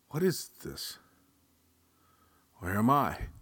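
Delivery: high-pitched, disoriented questioning; dizzy exclamation. Acted. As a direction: exclaim it, dazed and confused. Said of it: "exclaimed, dizzy and strange".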